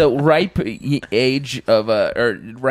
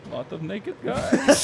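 mock Russian accent; 7 semitones higher, 4 dB quieter, slower than usual. Russian agent.

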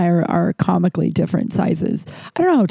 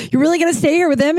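repeated higher and outraged; retelling the fight, pitched up in disbelief; mock incredulous; 9 semitones higher, much faster than usual. Repeats same question, pitched up.

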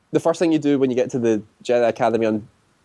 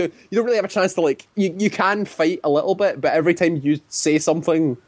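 normal talking voice

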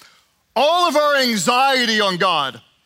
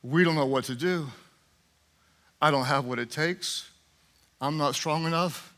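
quiet honest prayer; 7 semitones lower, 7 dB quieter than usual. Acted. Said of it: "And then he says".

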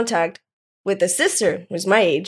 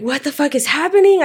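higher-pitched, alarmed disbelief; retold shock; 7 semitones higher, 3 dB louder than usual. Telling friend how she reacted to the mom outside, retold shock. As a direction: recreate your startled reaction, voice jumping up.

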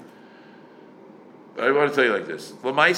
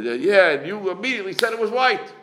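challenging; 9 semitones higher than usual. Retold or imitated. Quoting challenge to quick ruling.

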